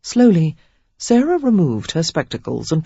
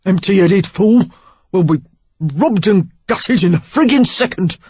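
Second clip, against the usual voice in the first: loud angry Cockney outburst; 3 semitones higher, 6 dB louder than usual. A narrator's reading. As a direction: bark it at her in a Cockney accent. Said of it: "with a Cockney twang".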